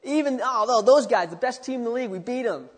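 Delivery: high, loud victorious whooping; excited locker room cheer. Acted. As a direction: cheer like you just won the championship. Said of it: "what they say".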